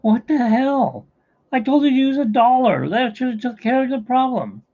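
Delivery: hammed-up disbelieving shout; mock outrage. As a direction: scold him, fed up. Wop yells at Pollock.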